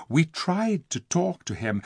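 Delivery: ordinary delivery, nothing marked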